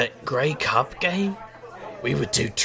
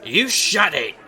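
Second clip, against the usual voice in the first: mock snap; 7 semitones higher, slower than usual. Valentine snapping back at Razor's teasing, playful. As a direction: bark it back in fun.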